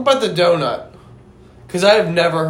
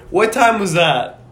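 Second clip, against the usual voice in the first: normal talking voice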